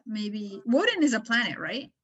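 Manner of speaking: ordinary talk, nothing marked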